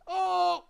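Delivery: high yelp like a pop star. Retold or imitated. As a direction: hit a sharp high "Oh" like the singer. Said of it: "like that [name]".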